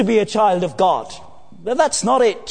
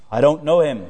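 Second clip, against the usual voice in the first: Peter denying; 5 semitones lower, faster than usual. Acted. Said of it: "says Peter".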